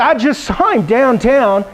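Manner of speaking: tut-tut about dad's date, slowly; doctor gossiping about dad, mock-shocked; mock scandalized doctor. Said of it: "He said".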